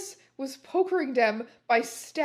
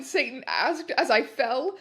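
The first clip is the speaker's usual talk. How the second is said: usual speaking voice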